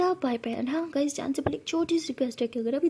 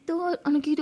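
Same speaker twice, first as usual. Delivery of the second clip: ordinary delivery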